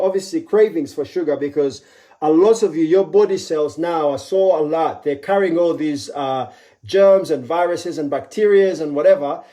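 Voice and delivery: ordinary talk, nothing marked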